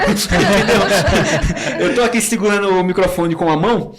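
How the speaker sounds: talking through laughter; laughing. Real, not acted. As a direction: laugh while explaining. Rindo ao contar da mão. (falando entre risadas)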